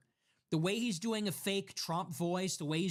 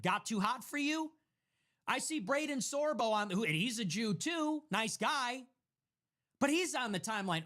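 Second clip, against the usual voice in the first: normal talking voice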